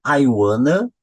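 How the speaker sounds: "want to" merged into "wanna", rising; linked reduction. Shown as a demonstration. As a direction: model "wanna" as one joined word. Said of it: "eu já faço a mesma junção".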